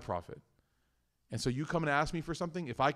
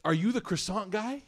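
mimicking her; 9 semitones higher than usual. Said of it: "she goes".

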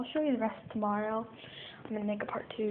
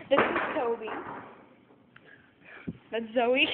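normal talking voice

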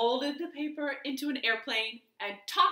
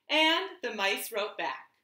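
ordinary delivery

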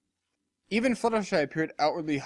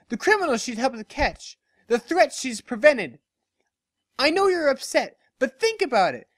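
much higher, louder impassioned plea; protective earnest friend; 9 semitones higher, 3 dB louder than usual. Acted. Barry rearing up to defend Lyra from the crowd, impassioned. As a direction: shield her with words.

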